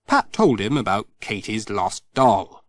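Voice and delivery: ordinary delivery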